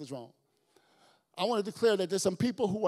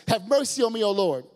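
loud high plea, have mercy; desperate loud plea; pleading cry; 4 semitones higher, 12 dB louder than usual. Acted. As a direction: cry out to the Lord, loud and higher. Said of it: "she says".

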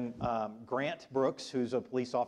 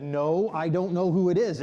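loud, higher-pitched protest; agitated denial; 6 semitones higher, 11 dB louder than usual. Retold or imitated. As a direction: deny it loudly, getting worked up. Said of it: "he starts getting more agitated".

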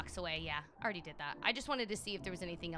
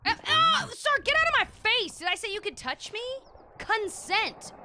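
much higher, loud indignant yell; outraged shout; 14 semitones higher, 13 dB louder than usual. Acted. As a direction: shriek him away in mock outrage. Shoving off a grabby NPC.

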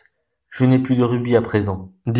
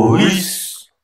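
high ringing call across the harbour; 8 semitones higher than usual. A narrator's reading. Sparrow calling to the sailors at the port, high call. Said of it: "criait-il".

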